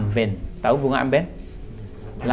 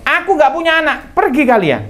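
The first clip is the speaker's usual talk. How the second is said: acted high, loud angry mother; angry disowning; 5 dB louder than usual. Mother sending away the messengers, acted angry shout.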